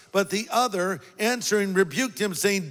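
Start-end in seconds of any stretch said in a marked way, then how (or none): none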